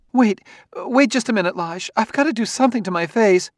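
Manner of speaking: high, hurried woman's voice; flustered wife. A narrator's reading. Wife stopping husband to fix her face, flustered. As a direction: plead for a moment, high and urgent.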